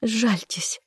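high, frightened maid's voice; pleading servant girl, pitched high; trembling plea. A narrator's reading. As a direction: beg fearfully to be spared. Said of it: "Её губы дрожали".

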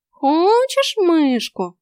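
slow, high teasing cat voice; drawn-out tempting offer; sly cat. A narrator's reading. Talking cat teasing the girl, slow and high.

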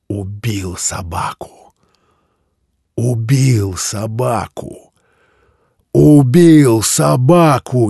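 slow creaking chant building to a shout; cold mad glee. Acted. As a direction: gloat slowly, rising to a high shout. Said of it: "скрипучим голосом ... холодное, безумное ликование".

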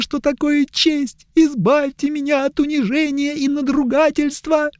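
put-on high, pleading young elf; whiny elf. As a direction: beg high and tearful.

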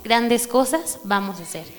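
ordinary delivery

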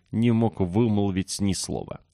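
usual speaking voice

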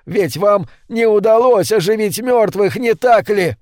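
high-pitched insistent argument; emphatic cross-questioning in a fight; urgent challenge. A narrator's reading.

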